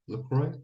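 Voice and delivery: LaCroix said as "luh-KROY", softly; unsure pronunciation. Shown as a demonstration. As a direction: try LaCroix with a KROY ending.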